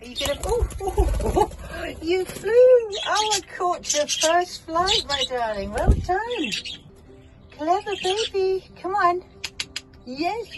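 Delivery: very high, loud delighted squeals; thrilled squealing. Real, not acted. Baby bird's first flight.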